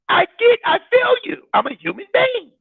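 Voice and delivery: high, whiny mock of her feelings; a hint of a tearful budgeter; mimicking emotional woman. Mocking the budgeter who feels the shortfall, high and teary. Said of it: "then you start getting emotional".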